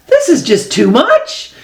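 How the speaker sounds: an uncle throwing up his hands in despair; exasperated uncle. Acted.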